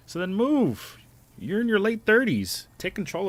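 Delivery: high, loud, incredulous jab; exasperated outburst. Real, not acted. Streamer scolds a chatter in his late thirties, loud exasperated. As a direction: throw it at them, half laughing in disbelief. Heated retort to chat user.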